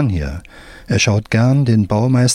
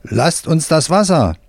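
shouting worker; 6 semitones higher than usual. A narrator's reading. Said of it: "bis ein Arbeiter schreit".

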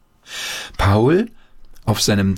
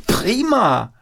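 delighted. A narrator's reading. Paul delighted.

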